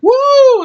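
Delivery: high, loud "woo"; party whoop. Retold or imitated. Imitating a young woman whooping on a boat at a party, excited whoop. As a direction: let out a long high party cheer. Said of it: "she's like".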